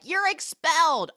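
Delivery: high, loud mock pronouncement; indignant declaration. Vice principal expelling him as he storms out, mock indignant declaration. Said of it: "and she's like".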